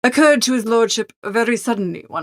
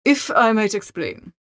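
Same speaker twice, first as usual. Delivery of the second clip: plain reading voice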